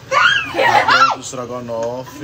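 slow, laughing call that food's ready. Real, not acted. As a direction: announce dinner with a laugh. Announcing food is ready. (chamando para o jantar rindo)

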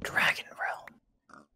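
slow, drawn-out whisper; hushed whisper. Pretend. Naming the dragon city to a friend, whispered. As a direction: whisper it slowly for effect.

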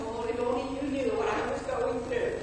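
mock woe-is-me moan, droned softly; put-on plaintive complaining; self-pitying moan. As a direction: moan it softly like someone throwing a pity party. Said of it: "having a good cry and a good moan".

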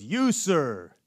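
single out the man in the back; preacher pointing out a listener loudly; calling out. Retold or imitated. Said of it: "And he goes".

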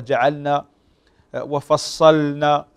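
usual speaking voice

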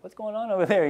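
put-on a light child's voice calling out; curious boy. Brother calling across the bed to his sister. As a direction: call across the bed, puzzled. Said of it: "He's like".

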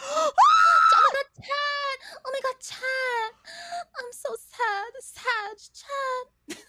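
high, wailing cartoon girl crying over Chad; Vei Vei impression. Imitating Vei Vei, high wailing cry for Chad. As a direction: sob dramatically for Chad, high and shrill. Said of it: "My favorite one's doing Vei Vei's".

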